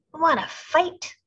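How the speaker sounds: high, loud challenge; grouchy ladybug. A narrator's reading. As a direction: spoil for a fight. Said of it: "said the grouchy ladybug".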